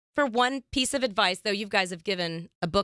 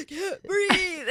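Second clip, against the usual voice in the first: mock choking; 9 semitones higher than usual. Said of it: "and I went".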